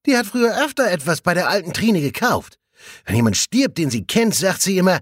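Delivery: put-on chatty young colleague confiding; colleague Jasper. A narrator's reading. Jasper talking about his mother. (leicht gespielter junger Kollege)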